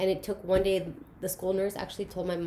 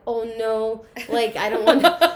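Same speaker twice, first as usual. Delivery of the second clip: laughing refusal; 2 semitones higher, 8 dB louder than usual. Retold or imitated. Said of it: "I'm like".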